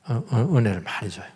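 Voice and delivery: normal talking voice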